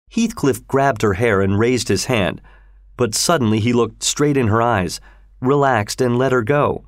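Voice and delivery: usual speaking voice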